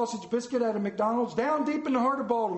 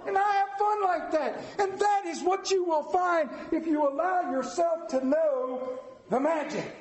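rising exhortation; 10 semitones higher than usual. Real, not acted.

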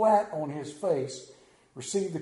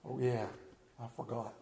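sheepish mumble; 8 semitones lower, 12 dB quieter than usual. Acted. Peter sheepish reply.

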